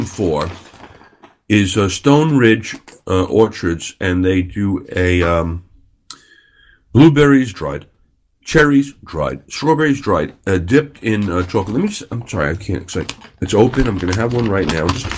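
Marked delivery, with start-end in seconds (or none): none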